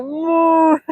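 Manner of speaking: long drawn-out moo; cow moo. Pretend. Imitates a cow after joking.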